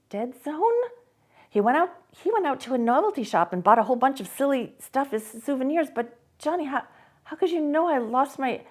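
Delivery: Sarah pressing Johnny with growing unease; Sarah puzzled. A narrator's reading.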